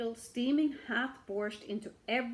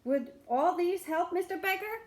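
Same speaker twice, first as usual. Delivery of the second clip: townsfolk asking; 9 semitones higher, 5 dB louder than usual. A narrator's reading. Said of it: "they asked".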